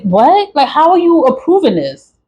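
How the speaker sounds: high-pitched, disbelieving challenge; incredulous. Real, not acted. Woman venting at the courthouse that approved the papers, incredulous. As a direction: demand an explanation in disbelief.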